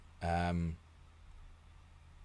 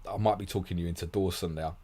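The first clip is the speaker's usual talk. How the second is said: ordinary talk, nothing marked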